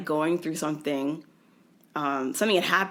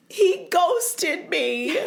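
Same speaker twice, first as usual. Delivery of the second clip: much higher, dragged out slowly; wailing childlike; 11 semitones higher, 3 dB louder, much slower than usual. Retold or imitated. Groggy patient wailing to the nurse about her ex. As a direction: whimper it like a four-year-old. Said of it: "I was like".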